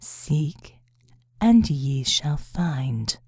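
deadpan ancient proverb, drawn out low; dry reply. A narrator's reading. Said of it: "the seraphim replied dryly".

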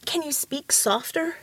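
usual speaking voice

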